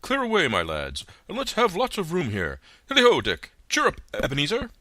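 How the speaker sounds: loud, high, hearty calling out; fat jovial voice. A narrator's reading. Fezziwig calling his apprentices, loud and jovial. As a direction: boom it out like a jolly old master. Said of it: "cried old Fezziwig".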